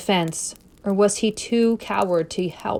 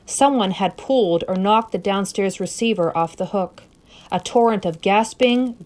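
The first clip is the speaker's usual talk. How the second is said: ordinary delivery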